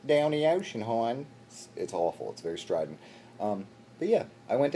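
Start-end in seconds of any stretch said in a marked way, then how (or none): 0.0-1.3 hammed-up Baltimore "down the ocean, hon"; Baltimore accent. Showing the awful local accent, strident Baltimore. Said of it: "It's a terrible accent".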